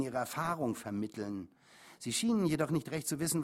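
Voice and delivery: ordinary delivery, nothing marked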